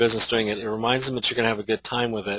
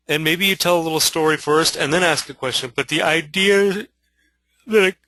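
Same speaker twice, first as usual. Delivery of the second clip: yawning; 5 semitones higher, 3 dB louder than usual. Real, not acted. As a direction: let a yawn stretch the words out. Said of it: "excuse me for yawning again".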